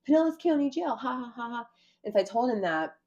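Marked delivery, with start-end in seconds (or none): 0.1-1.7 high, taunting cop voice with a fake laugh; a cop mocking his prisoner, high and taunting; mocking officer. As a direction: sneer it out like a gloating jailer.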